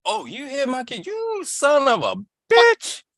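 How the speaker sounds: high, slow, mock-outraged bear mother; angry mother bear. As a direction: play the furious mama bear, stretched out and high.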